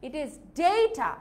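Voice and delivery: slow "data" as DAY-ta; English pronunciation. Shown as a demonstration. Teacher gives the English way, data as a slow DAY-ta. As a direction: stretch data out with "day" up front. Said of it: "ইংরেজিতে কিভাবে উচ্চারণ করব?".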